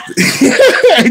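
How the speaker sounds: high burst of laughter. Real, not acted. Host laughing at his own test prank. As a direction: laugh out at your own joke.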